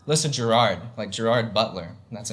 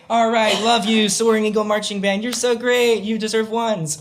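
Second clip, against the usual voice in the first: higher, a touch louder, hammed-up proud parent; cheesy parent message; 9 semitones higher, 4 dB louder than usual. Parents sending a shout-out to the marching band, in a high hammed-up gush. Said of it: "your parents could say".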